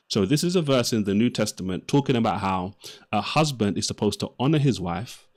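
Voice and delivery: ordinary talk, nothing marked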